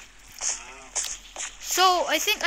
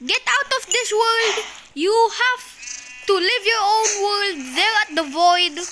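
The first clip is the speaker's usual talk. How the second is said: raised, hammed-up angry order; mock villain banishment; 5 semitones higher, 5 dB louder than usual. Player banishing a pig, play-acted anger. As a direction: banish them in a higher, mock-furious voice.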